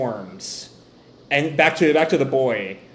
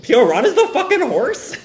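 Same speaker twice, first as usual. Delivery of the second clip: high, loud disbelief, retold with laughter; incredulous outburst; 12 semitones higher, 5 dB louder than usual. Podcaster retelling a forgotten plot twist, incredulous. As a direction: blurt it out in amused astonishment. Retelling own stunned discovery.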